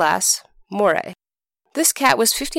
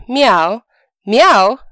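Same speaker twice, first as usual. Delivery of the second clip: loud drawn-out cat meows; 3 semitones higher, 7 dB louder than usual. Acted. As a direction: meow twice like a cat. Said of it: "said old Tom again".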